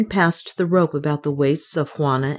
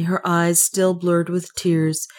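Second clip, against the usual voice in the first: usual speaking voice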